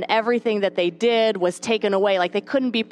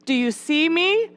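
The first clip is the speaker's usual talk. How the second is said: pleading prayer; 7 semitones higher than usual. Retold or imitated. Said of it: "we can feel like".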